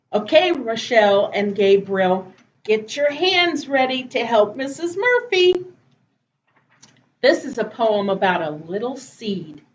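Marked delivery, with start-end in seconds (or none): none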